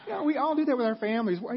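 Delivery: ordinary talk, nothing marked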